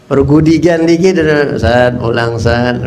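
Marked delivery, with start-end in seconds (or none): 1.6-2.8 a hint of a stern father calling, lower; lightly voiced dad summoning his son; low father's call. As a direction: call the boy home in a lower voice.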